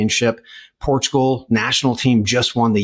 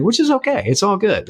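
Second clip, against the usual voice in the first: mock chipper; 10 semitones higher than usual. Joking about missing co-host.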